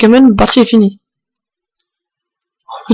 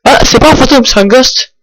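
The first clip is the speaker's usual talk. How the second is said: ordinary delivery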